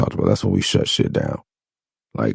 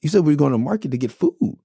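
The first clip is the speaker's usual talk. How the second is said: shocked disbelief; 10 semitones higher than usual. Retold or imitated. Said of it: "I'm like".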